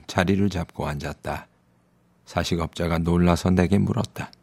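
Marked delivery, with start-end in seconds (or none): none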